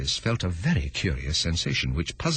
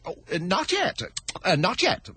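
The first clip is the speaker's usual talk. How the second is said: lightly voiced flustered rabbit; quick nervous rabbit; hasty rabbit; 11 semitones higher than usual. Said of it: "the rabbit hastily interrupted".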